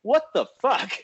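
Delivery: high-pitched mock panic; comic shocked exclamation; retold alarmed outburst. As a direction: blurt it out high, aghast. Passengers' retold panic reaction.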